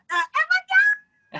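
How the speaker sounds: very high, slow ecstatic cry; crowd going wild. Retold or imitated. Crowd hearing the strings drop, very high slow ecstatic cry. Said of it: "crowd reaction to strings".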